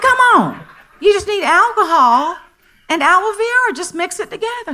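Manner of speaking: high, loud, eager coaxing that fades at the end. Retold or imitated. Telling her anxious girlfriend to mix sanitizer, high eager coaxing. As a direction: cheer her on, pitched high. Coaxing a friend over the phone.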